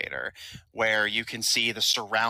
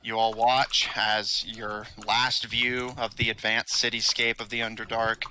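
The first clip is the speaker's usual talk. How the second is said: ordinary talk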